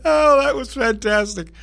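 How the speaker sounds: high, breaking out of laughter; delighted, voice lifted by laughter; laughing. Real, not acted. Host savouring his friend's wedding line, laughing.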